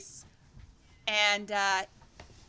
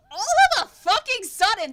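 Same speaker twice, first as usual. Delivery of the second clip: sudden loud narration; 8 semitones higher, 12 dB louder than usual. A narrator's reading. GM telling players about the gust, loud and sudden. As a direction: punch it loud and high as the gust hits.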